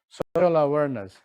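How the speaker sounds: ordinary talk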